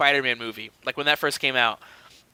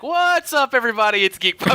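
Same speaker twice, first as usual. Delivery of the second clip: high, hyped-up show opener; mock-cheery intro, pitched way up; podcast greeting; 6 semitones higher than usual. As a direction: burst in like a podcast host greeting fans. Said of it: "I want you to be like".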